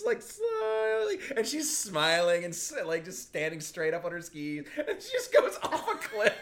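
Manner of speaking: high laughing storytelling. Real, not acted. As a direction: crack up while telling it. Laughing telling funny scene.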